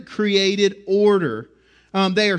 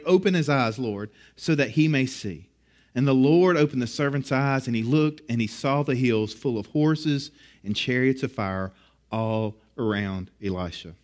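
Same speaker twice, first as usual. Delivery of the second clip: low, solemn Bible narration, sinking softer at the end; hushed scripture reading; 7 semitones lower, 4 dB quieter than usual. Acted. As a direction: read it reverently, well below your usual pitch. Reads Elisha's prayer and the miracle.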